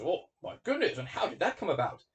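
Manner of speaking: lightly acted shock, then a puzzled question; shocked colonel. Colonel hearing that Elliot's wife knows, a bit shocked. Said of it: "the Colonel looks a bit shocked".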